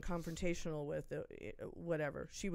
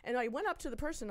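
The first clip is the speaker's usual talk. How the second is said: ordinary delivery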